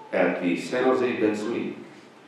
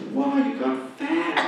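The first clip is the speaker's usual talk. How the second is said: high, laughing mock of a blunt friend; blunt old friend; 14 semitones higher, 3 dB quieter than usual. Old friend greeting her after twenty years, blunt high mock. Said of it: "She says".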